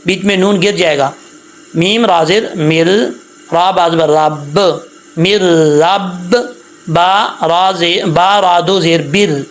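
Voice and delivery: slow, deliberate letter-by-letter spelling; teacher spelling out the verse. Shown as a demonstration. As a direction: spell each syllable slowly for students. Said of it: "ایک دفعہ پھر میں یہاں پہ ہجے کر دیتا ہوں".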